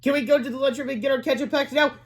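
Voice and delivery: put-on high-pitched kid voice; child asking. A narrator's reading. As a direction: plead like a pupil in class. Child asks teacher a question.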